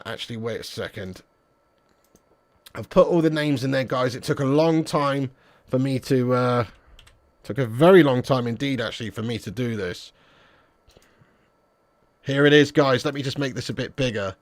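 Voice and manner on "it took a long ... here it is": normal talking voice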